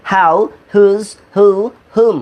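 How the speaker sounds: "how", "whose", "who", "whom" each opened with an audible h; pronounced with H. Shown as a demonstration. Teacher repeats the four exceptions for students, pronounced with h. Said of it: "হ দিয়ে".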